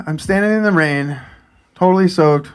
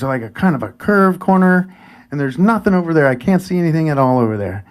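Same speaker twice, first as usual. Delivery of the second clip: usual speaking voice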